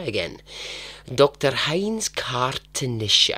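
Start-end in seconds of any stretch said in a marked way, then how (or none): 1.1-3.4 slow, deliberate mock German accent on the name. YouTuber retrying a German doctor's name, mock German accent. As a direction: try the name again in a hammed-up German accent. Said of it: "I don't know why I've developed this accent".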